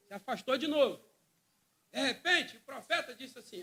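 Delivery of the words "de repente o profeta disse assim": normal talking voice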